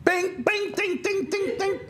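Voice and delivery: high bright bing ding ding; mock ding sounds. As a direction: ring it out like a bell. Tooth falling, sound effect.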